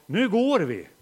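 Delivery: loud parental command; firm call to go. Retold or imitated. Father tells family at Ströget, loud firm call. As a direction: call the family to leave now. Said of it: "så säger jag".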